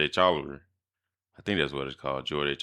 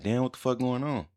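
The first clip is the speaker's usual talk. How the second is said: high, bewildered retold cry; startled and much higher, at normal volume; the same puzzled outburst told a second time; confused exclamation; 8 semitones higher than usual. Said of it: "I'm like".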